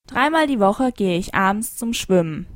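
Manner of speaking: ordinary talk, nothing marked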